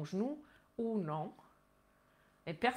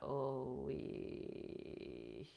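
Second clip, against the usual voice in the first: relaxed sigh; 6 semitones lower, 10 dB quieter than usual. Retold or imitated. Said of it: "Mon système nerveux est en train de faire".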